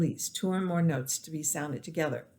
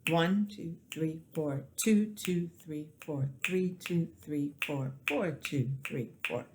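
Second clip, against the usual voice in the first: clap-along count, punch the one; accent on one, rest falling away; stress on the one; 3 dB quieter than usual. Shown as a demonstration. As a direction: count where each ONE is leaned on. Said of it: "I put more emphasis on the one".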